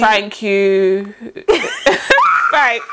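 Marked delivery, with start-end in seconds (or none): 1.2-2.5 sudden high burst of laughter; squealing giggle. Real, not acted. Laughing at imagined thanks.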